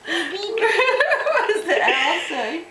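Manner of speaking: slow, high, giggly question; fighting off the giggles; laughing. Real, not acted. Mother still giggling at book.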